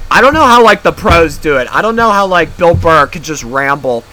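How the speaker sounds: high, loud bursts that ease down; loud exasperation. Real, not acted. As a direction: complain out loud in disbelief at how others manage. Exasperated at having to keep talking.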